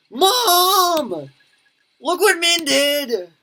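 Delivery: loud, high, slow yelling; little brother yelling to mom about his sister, high and loud; kid tattling. Acted. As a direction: shout for mom to come see.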